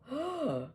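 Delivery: sharp high gasp of delight. Real, not acted. Fan opening the album, delighted gasp. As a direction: gasp as if caught off guard. Unboxing reaction.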